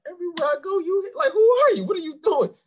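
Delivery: high, loud incredulous voice; mock baffled director. Director amazed to keep seeing him, high incredulous. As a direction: play the stunned man you keep running into. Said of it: "he would be like".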